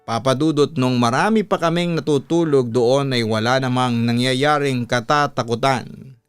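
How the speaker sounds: usual speaking voice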